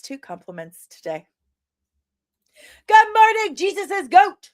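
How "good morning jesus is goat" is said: high, loud sing-song hello; cheery shout-out to a chat viewer; big shouted greeting. Real, not acted. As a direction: belt the good morning across the room.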